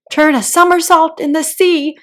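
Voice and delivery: loud, high, capering cry; wild cry. Acted. Mock Turtle capers about, crying out wildly. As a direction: call it out while dancing wildly. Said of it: "cried the Mock Turtle".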